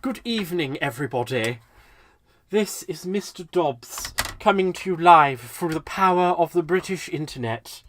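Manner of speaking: exaggerated British broadcaster's announcement; hammed-up British accent, addressing the nation; falsified British accent. Shown as a demonstration. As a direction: put on a mock-formal British presenter voice. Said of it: "the falsified British accent".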